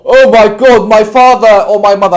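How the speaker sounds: loud, high-pitched mock complaining; exaggerated poor-me outburst; mock lament. Acted complaint, mocking trauma-dwellers.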